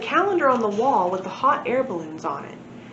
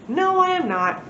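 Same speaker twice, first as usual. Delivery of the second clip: higher, emphatic no; a raised, firm denial; firm refusal; 9 semitones higher than usual. Acted. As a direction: insist brightly that you won't. Narrator firmly refuses.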